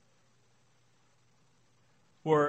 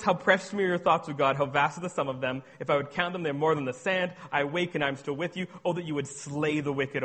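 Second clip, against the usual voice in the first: psalmist's swing from praise to fury. Acted. Preacher voicing the psalmist, gushing praise that snaps to wrath. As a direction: gush over God, then snap at the wicked. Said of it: "He goes from, he goes".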